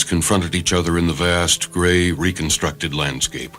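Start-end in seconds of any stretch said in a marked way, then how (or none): none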